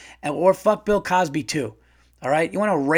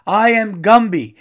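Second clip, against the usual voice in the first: higher, louder cranky Gumby; imitating Gumby; 6 semitones higher, 8 dB louder than usual. Comedian quoting Gumby demanding respect, higher and louder. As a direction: bellow it like a washed-up claymation star. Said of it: "and he's like".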